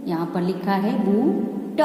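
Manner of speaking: ordinary delivery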